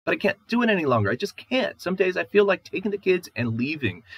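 high, loud, despairing plea close to a sob; wife at the end of her strength, breaking toward a sob; near tears. Acted. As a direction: beg him to understand, voice about to break. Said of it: "Shamali's voice disappears into a sob".

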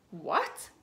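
sharp cross what. A narrator's reading. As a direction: snap back, offended. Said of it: "says Mummy Pig crossly".

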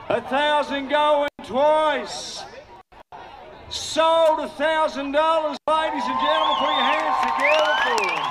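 slow, stretched hammer-fall call; auctioneer closing a sale. Real, not acted. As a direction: draw out the final call, then announce the sale.

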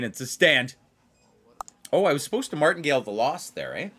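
normal talking voice

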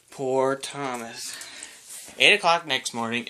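ordinary delivery, nothing marked

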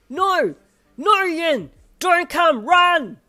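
high, loud, frantic warning; panicked shouting. A narrator's reading. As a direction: scream a desperate warning to keep away. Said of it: "heard your voice and panicked".

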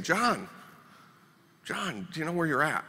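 a hint of an unfamiliar nurse waking him; nurse calling softly. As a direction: gently rouse a waking patient. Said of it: "She was saying".

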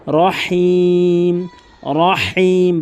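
রহিম said slowly with a breathy হা from the throat; slow রহিম with a hot-air হা. Shown as a demonstration. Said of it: "বড় হা".

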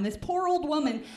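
ordinary delivery

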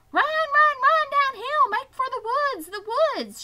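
urgent goose hollering him to flee; goose shouting. Acted. Goose hollers to Wilbur to escape, very loud high shout. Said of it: "she shouted to Wilbur".

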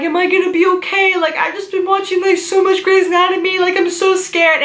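high, fast, frightened pleading; panicked crying. Retold or imitated. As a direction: imitate your own tearful panic to the EMT. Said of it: "I'm, like, bawling".

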